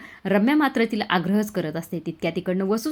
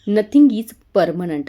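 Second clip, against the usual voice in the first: slow, pointed; 8 dB louder, much slower than usual. A narrator's reading. Arya's pointed line to Parth.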